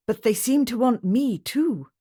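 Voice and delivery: a Scottish husband waking his wife in danger; urgent Scottish shepherd. A narrator's reading. James voiced by narrator.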